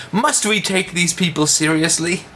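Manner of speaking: high, haughty mimicking voice; sneering mimicry; mock pompous. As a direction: parody the author's disdain. Mocking the author's question.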